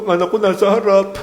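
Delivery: proud parent bragging, lightly sent up; mock proud parent. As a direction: lightly play the beaming parent showing off their kid. Proud parent voiced mockingly.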